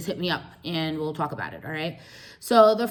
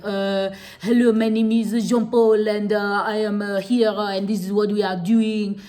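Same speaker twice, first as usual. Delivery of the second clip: a hammed-up Frenchman speaking English, louder; French accent; 3 semitones higher, 5 dB louder than usual. Explaining how French speakers sound, mock French accent. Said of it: "speaking English with a French accent".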